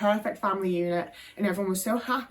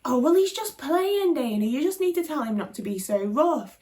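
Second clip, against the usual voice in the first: dismissive mum; 5 semitones higher than usual. Retold or imitated. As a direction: wave the bruises away lightly. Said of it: "she was like".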